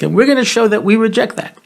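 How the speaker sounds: usual speaking voice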